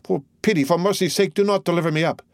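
high-pitched pleading; urgent entreaty, held up in pitch; supplicating. A narrator's reading. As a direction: implore them for mercy. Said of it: "remained silent to this supplicating voice".

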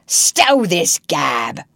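loud, slow pirate roar; slow booming pirate command; roaring captain. A narrator's reading. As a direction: bellow it down at them like Hook. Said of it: "roared Hook".

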